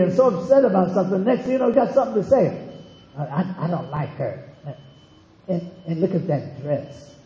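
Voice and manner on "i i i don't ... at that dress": lower, hushed, snide stammering gossip; catty gossip. Acted. A gossiper whispering about another woman's dress, catty and hushed. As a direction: sneer about her dress behind her back.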